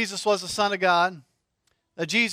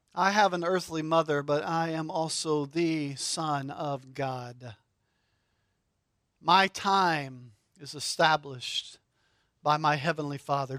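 solemn Jesus voice; 7 semitones lower, 7 dB quieter than usual. Acted. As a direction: speak as Jesus, quietly certain.

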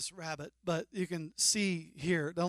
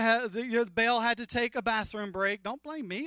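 ordinary talk, nothing marked

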